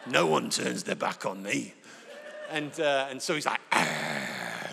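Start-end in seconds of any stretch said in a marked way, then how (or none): 0.1-1.7 much lower, growling villain dog; menacing dog voice. Retold or imitated. The dog Havoc threatens the preacher, lower and menacing. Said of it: "I can hear him going". 3.7-4.7 low mock growl; dog growl. Havoc pins the preacher down, low growl. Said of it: "like this".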